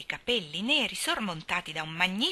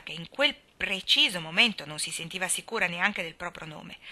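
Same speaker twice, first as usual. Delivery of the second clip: ordinary delivery, nothing marked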